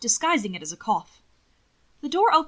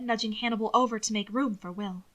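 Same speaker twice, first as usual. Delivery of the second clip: plain reading voice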